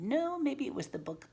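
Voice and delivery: ordinary talk, nothing marked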